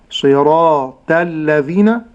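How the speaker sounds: slow صراط الذين with ta lightened to a plain t; wrong thinned ta. Shown as a demonstration. Sheikh demonstrating the common error, صراط الذين with light ta. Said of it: "النطق الخطأ".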